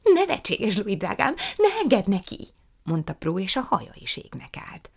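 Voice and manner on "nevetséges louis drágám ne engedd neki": high, loud scandalised outburst; appalled protest. A narrator's reading. Prue begs mama not to allow it, appalled. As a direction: protest loudly, pitch jumping up.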